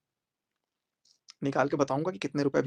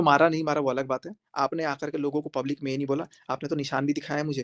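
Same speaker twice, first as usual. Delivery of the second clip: ordinary talk, nothing marked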